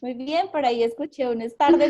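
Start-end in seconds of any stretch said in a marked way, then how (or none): 1.4-1.9 "es tarde" imitated, louder and bursting out; surprised. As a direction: echo the student's startled "es tarde". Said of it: "muy sorprendido". (imitando un "es tarde" sorprendido)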